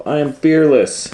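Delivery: loud, high shaky insistence; hammed-up courage, trying to believe it; forced bravado. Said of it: "tried to convince herself".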